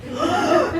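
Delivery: a sharp gasp of mock fright; frightened gasp. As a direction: gasp as if caught out, hammed-up. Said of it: "Gasping in frightened discovery".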